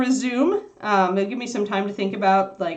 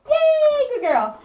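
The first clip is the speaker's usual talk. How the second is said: very high, delighted cheer; high, gleeful "good dog" voice; puppy praise; 4 dB louder, slower than usual. Real, not acted.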